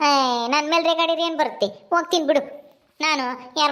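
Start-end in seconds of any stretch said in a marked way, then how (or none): none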